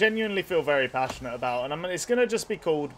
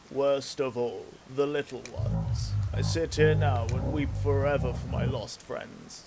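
slow, calm and brooding, a touch lower, like Morgan Freeman; deep brooding voice; 2 semitones lower, slower than usual. Shown as a demonstration. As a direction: weep it out slowly like an old brooding guardian. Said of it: "Something deep and brooding".

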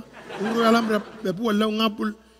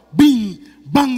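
bombas imitadas; 8 dB louder than usual. Retold or imitated. Bombas cayendo del avión.

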